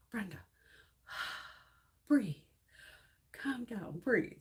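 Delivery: mother soothing her son at ninety; calming mom. Retold or imitated. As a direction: talk a panicked driver down gently. Mother calming the speeding driver.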